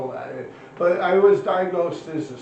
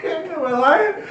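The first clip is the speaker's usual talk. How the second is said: laughs out the question, high and loud; cracking up while asking; laughing; 14 semitones higher, 7 dB louder, much slower than usual. Real, not acted. Laughing at his own story.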